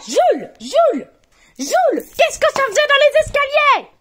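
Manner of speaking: high, loud furious shout for Jules; mother yelling up the house at her son; angry call. Acted. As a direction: summon the child in a rage. Mother angrily calls her son.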